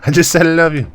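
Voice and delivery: put-on high boyish voice, laughing at himself; young son voice. Son says love you.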